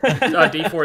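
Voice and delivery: ordinary talk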